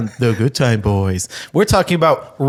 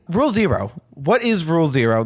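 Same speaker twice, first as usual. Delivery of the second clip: usual speaking voice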